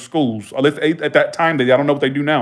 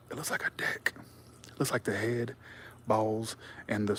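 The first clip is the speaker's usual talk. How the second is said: whispered aside; hushed, unvoiced joke; 3 semitones lower, 15 dB quieter than usual. Real, not acted. Whispered joke about the pool.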